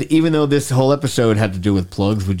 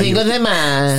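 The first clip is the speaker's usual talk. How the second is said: higher, slow mock Spanish "Cinco de Mayo"; 7 semitones higher, slower than usual. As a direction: drawl out "Cinco de Mayo" like Ed does. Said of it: "Ed likes to say it with the".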